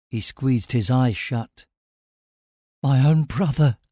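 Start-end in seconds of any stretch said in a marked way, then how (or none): 2.8-3.8 understated grief with a slight catch; voice breaking. A narrator's reading. Stellos recalls his lost brother, voice breaking. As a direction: confess it, mildly choked. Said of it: "his voice breaking".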